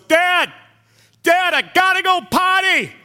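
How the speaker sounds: put-on loud, high little boy call; a kid shouting over his headphones; real loud. Five-year-old son on a plane, shouting to dad. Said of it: "Real loud".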